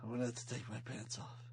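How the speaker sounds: low, soft tough-guy voice; Snake voice again. Pretend. Host joking as Snake to co-host, low and soft. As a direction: keep it low and quiet.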